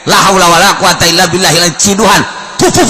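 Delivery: ordinary talk, nothing marked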